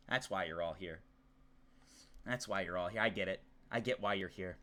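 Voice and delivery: low, very soft resignation; mock wounded. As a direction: play quietly hurt, almost to yourself. Pretends to be hurt by chat.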